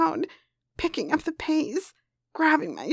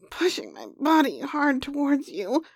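usual speaking voice

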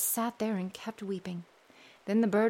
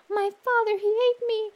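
much higher, louder bird voice; bird's song; 12 semitones higher, 6 dB louder than usual. Acted. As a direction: pipe it out high and bright. Bird sings.

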